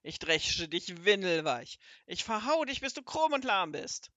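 a wizard ranting and threatening a beating; furious wizard storms into the kitchen yelling; raging. Acted.

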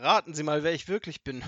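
loud, teasing challenge; gloating taunt. A narrator's reading. Robber invites grandmother to guess who he is, gloating. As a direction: relish the reveal.

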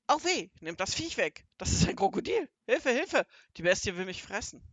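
high, loud panicked yelling; frantic robber shrieking for rescue; screaming blue murder. A narrator's reading. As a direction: cry for help at the top of the voice. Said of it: "schrie der Räuber Hotzenplotz, Zeter und Mordio".

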